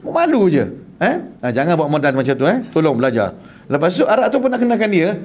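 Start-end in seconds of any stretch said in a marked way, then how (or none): none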